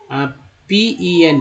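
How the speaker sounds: slow, drawn-out letter-by-letter spelling; name each letter slowly; spelled out letter by letter. Shown as a demonstration. Teacher spelling pen for students, slowly letter by letter. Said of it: "पेन".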